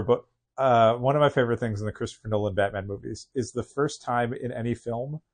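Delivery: ordinary talk, nothing marked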